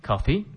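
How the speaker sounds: "Coffee?" with pitch rising at the end; uptalk. Shown as a demonstration. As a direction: ask "Coffee?" with a lifted final syllable. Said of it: "語尾を上げないといけない".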